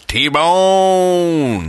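high, loud, stretched T-Bone callout; drawn-out hype callout. Shown as a demonstration. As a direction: belt out the name like an announcer. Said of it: "try this. Just go".

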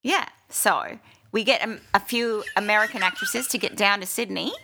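mock-indignant Australian banter; Australian accent. Actress jokes about Americans taking Australian parts, Australian accent. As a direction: argue the joke through in an Australian accent.

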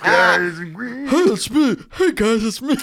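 high-pitched, goofy Muppet; botched [name] that comes out as a Muppet. Acted. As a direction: greet them like a high Muppet. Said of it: "This is a Muppet".

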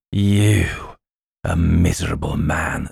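scornful goblin king sneer; withering put-down. Acted. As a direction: lay the contempt on thick. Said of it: "in a more contemptuous tone than before".

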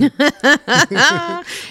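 loud high cackling laugh; loud laugh at his own joke; burst of laughter. Real, not acted. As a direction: crack up helplessly.

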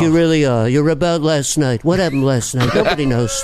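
flattering Trump mumble; Trump impression. Trump flattering the crowd, in his voice. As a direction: gush praise in a Trump voice. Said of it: "Trump is Brando and Regis".

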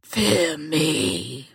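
low, drawn-out villain voice; menacing catchphrase. Acted. Answering a greeting with her catchphrase, menacing. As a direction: intone it low like a looming villain. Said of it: "we need some new catchphrases come from you".

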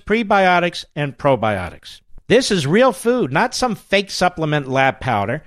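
upbeat host-read ad. Acted. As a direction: sell it like a radio ad. Host reads sponsor ad.

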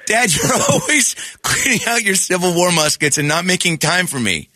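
put-on high, whining young boy; whiny kid voice. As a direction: complain like a neglected kid. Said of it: "I'm gonna be the kid".

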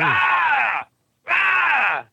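high-pitched mimicked "aah" yell; drawn-out, high mock scream; imitated Sasquatch yell. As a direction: imitate a creature yelling into someone's face. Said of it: "shoving it in my face, going".

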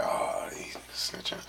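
low, slow whisper; dad's muttered aside. Retold or imitated. Dad caught out, murmuring low and slow to himself. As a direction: drop way down and murmur it. Dad reacts to being exposed.

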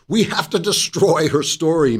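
put-on German accent. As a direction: read it like a stern German villain. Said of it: "You have to picture that with a German accent".

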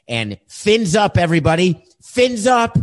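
cheesy upbeat sign-off, pitch climbing; mock Tua cheer. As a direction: pump up the fans like a forced PR quarterback. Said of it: "we've got Tua who wants to address this issue".

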